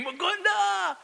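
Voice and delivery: yelling at his son over Legos on the floor; shrieked parental scolding; scream. Retold or imitated. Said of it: "and then I'm screaming".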